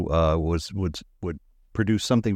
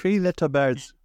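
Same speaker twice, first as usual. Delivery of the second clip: put-on Jamaican accent; 7 semitones higher than usual. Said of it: "with a Jamaican accent".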